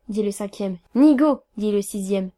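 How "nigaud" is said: loud, contemptuous name-calling; emphatic one-word rebuke; scornful insult. Acted. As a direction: fling the word out loudly. Friends insult the disgraced narrator.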